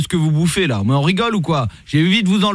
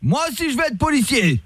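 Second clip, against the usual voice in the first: mock dictation; 8 semitones higher than usual. Fake cop making the rapper recite, mock coaxing. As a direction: coax him like a child. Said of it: "commissaire Latour".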